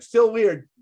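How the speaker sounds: a firm verdict, no apology; blunt retort. Retold or imitated. To the owner, a firm deadpan retort. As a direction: still weird, said flat and firm. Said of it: "I out loud was like".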